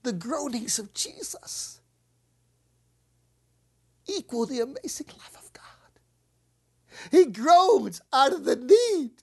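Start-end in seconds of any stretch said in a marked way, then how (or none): 0.0-6.0 hushed whisper. Real, not acted. Preacher quietly awed before the congregation. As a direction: drop to a near whisper.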